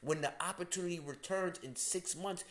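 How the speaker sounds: normal talking voice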